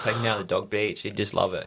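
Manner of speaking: ordinary delivery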